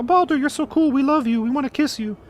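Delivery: high, gushing fangirl voice, mocking; mock adoration. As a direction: swoon over him like a silly admirer. Everyone adoring Baldur, mocked.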